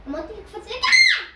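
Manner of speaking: loud, laughing repeat of the order; half yelling, half laughing; laughing shout. Real, not acted. Reminding his friend what he said, laughing shout.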